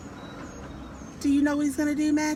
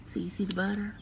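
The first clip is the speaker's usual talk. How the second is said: whisper; 5 semitones lower, 7 dB quieter, much faster than usual. Real, not acted. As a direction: share a secret with the listener. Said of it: "whispers to child".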